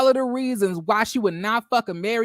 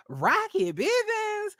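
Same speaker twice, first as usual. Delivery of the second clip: much higher, slow shrieking question; utter disbelief; 12 semitones higher, 4 dB quieter, slower than usual. Real, not acted. Repeating the lover's name to viewers, in high, slow disbelief. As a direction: stretch the name in total disbelief.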